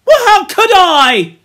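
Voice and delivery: high, loud indignant protest; Piggy, indignant cry. A narrator's reading. Piggy defends himself to Ralph, indignant shout. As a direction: cry out against the unfair blame. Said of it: "cried Piggy indignantly".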